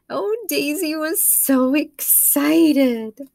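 high, slow, gushing; excited narration. A narrator's reading. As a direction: share the excitement.

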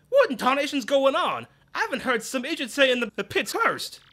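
hammed-up southern boss, worked up; Grubba's voice. Boss griping about rumors, hammed-up.

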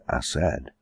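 usual speaking voice

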